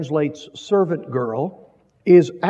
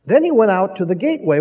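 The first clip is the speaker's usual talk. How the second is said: normal talking voice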